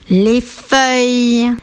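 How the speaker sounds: feuilles slow, its œil ending held; final sound stretched. Shown as a demonstration. Teacher gives a rhyme, les feuilles slowly stretched. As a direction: draw out the end of les feuilles. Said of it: "Un mot où on entend œil à la fin".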